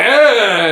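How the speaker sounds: high loud yelp; mock yell. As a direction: blurt a goofy yell. Kikuchiyo yelling.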